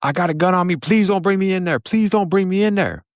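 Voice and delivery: desperate begging from the holding cell; frantic high-pitched plea; retold frantic screaming. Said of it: "I hear him screaming".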